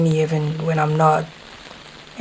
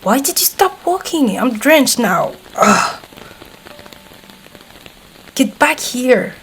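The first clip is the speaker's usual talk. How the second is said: high, loud yelling over the rain; annoyed shouting in the downpour; exasperated yell; 7 semitones higher, 3 dB louder than usual. Acted. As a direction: shout after them, fed up and soaked. Soaked in rain, yelling at listener.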